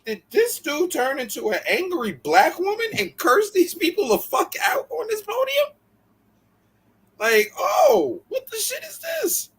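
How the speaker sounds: climbing mock outrage; incredulous retelling. Friends recall hearing Andre Chase curse out people, incredulous. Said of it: "we said".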